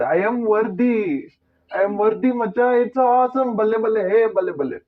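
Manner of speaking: gleeful brain cheering its own worth; joyful celebration. Acted. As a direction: celebrate like at a Punjabi party. Real king's brain celebrating.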